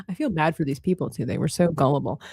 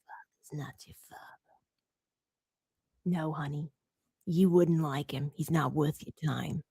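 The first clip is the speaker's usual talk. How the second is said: low, hushed Caribbean medium impression; whisper, then murmur it dismissively; very quiet mimicking of a TV psychic; Madame Cleo; 5 semitones lower, 10 dB quieter than usual. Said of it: "the Caribbean medium".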